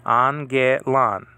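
slow "Angelon" in three clear syllables, an-ge-lon; syllable drill. Shown as a demonstration. Teacher drilling the word slowly for students. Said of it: "Three syllables here".

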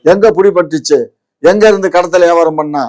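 heated political argument; indignant challenge. Real, not acted. Heated challenge to interviewer's accusation.

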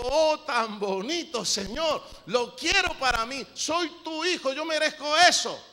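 loud, high, slow mock prayer of wanting; hammed-up begging for a gift from God; mock greedy believer. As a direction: ham up a believer coveting a shop window. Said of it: "Yo miro algo en la vitrina y digo".

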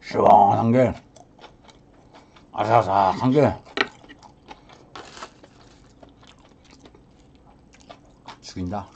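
talking while chewing; muffled through food; mouth full. Real, not acted. Seen 4: Cheeks bulge and jaw chews throughout; food on plate.

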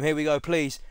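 ordinary talk, nothing marked

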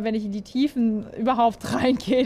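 ordinary talk, nothing marked

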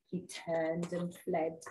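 ordinary delivery, nothing marked

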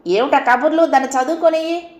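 annoyed call to stop the chatter; husband shouting. A narrator's reading. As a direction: yell across the house at the women. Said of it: "Ramanatham అరిచాడు".

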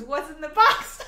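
high, loud Brad Pitt yell laughing; What's in the box. Retold or imitated. Quoting Brad Pitt at the Taco Bell drive-thru, loud and laughing. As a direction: shout it like the movie scene, cracking up. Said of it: "And he goes".

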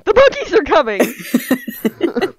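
hammed-up, drawn-out warning with laughter; mock alarm cry. Hammed repeat of scout's yelled warning.

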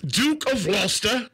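high, slow hammed-up English herald accent; bad English accent. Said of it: "his English accent need work".

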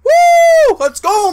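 very high, loud whoop and cheer; whoop, then cheer at full voice; triumphant whoop. Real, not acted. Player celebrating nice plays, triumphant whooping shout.

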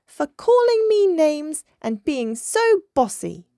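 put-on high, loud childish retort; indignant cry. A narrator's reading. Little dolphin answers her bossy cousin, indignant cry. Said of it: "cried Minnie Maui".

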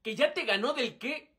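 ordinary delivery

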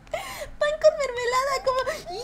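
high, whiny crying-style voice; mock squeaky voice. Streamer greeting chat in a high whiny put-on voice. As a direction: squeal it like a sobbing kid.

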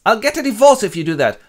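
high, loud furious threat; a hammed-up wife blowing up at her husband; angry mother. Parents argue over son.

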